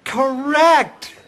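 loud, high game-show cry; emphatic announcement. Acted. Quizmaster tells intern the answer is right, emphatic cry.

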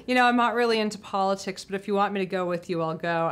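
ordinary talk, nothing marked

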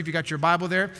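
ordinary talk, nothing marked

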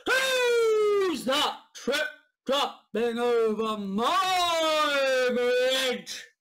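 slow, loud, drawn-out troll shout; troll's roar. Acted. Troll guarding his bridge, slow booming shout. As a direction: boom it out like a big troll. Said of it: "what trolls are supposed to say".

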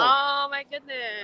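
real, lingering astonishment; amazed. Real, not acted. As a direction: stretch it out slowly in happy surprise. Learns of a very old follower.

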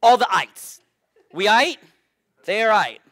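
loud, high joking call-out; hammed-up shouted wordplay; playful shout. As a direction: punch the pun like a cheer. Playful pun on ites.

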